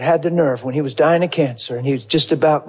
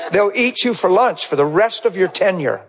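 ordinary talk, nothing marked

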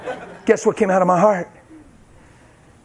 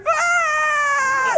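high crying shout; anguished cry. Real, not acted. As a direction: wail through tears. Both crying, messy scene.

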